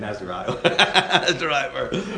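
amused, breaking into a laugh. Real, not acted. Preacher just kidding about the wrong verse, laughing. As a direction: chuckle through the reassurance.

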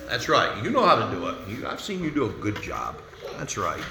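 soft coaxing parent voice. Real, not acted. Aside to a fidgeting child.

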